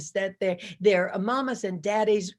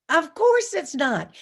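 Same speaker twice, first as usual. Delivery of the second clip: a firm, slow declaration; emphatic, slow and loud; 6 semitones higher, 5 dB louder than usual. Real, not acted. Speaker answering the crowd, slow, high and loud.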